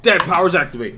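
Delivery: superhero call. Pretend. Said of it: "It's time for Dad Powers".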